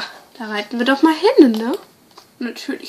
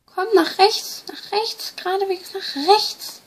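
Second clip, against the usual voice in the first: sing-song; 7 semitones higher, 4 dB quieter than usual. Acted. Coaxing the lamb along.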